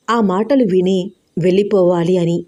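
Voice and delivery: ordinary delivery, nothing marked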